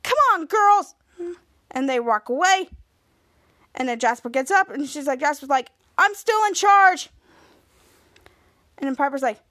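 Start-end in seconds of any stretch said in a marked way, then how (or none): 0.0-0.9 high bossy call to friends. Retold or imitated. Piper storms off after tantrum. 6.0-7.1 high, loud, slow protest; defiant call. Retold or imitated. Jasper calls after the departing girls.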